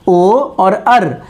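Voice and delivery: ओ और अर spoken very slowly, each sound held; ओ और अर, deliberate and slow; slow sounds demo. Shown as a demonstration. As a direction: hold each sound long for the class. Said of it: "इस प्रकार की ध्वनि".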